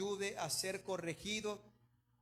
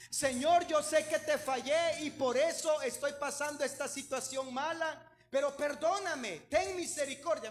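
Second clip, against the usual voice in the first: raised, loud pleading prayer to the Lord; 5 semitones higher, 4 dB louder than usual. Acted. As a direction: beg for mercy, voice lifted. Preacher prays aloud, pleading. (oración suplicante en voz alta)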